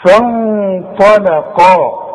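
فانطلقا slowly with the ن hidden and nasal before ط; slow recitation. Shown as a demonstration. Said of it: "هغن وأخفي".

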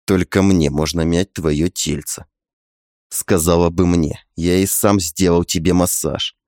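low, soft husband's voice; low male voice. A narrator's reading.

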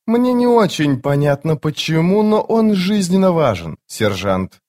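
high female scout, dropping on the last word; put-on high female voice; young woman's voice. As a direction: explain to a superior in a high girlish pitch. Scout explains to the sergeant.

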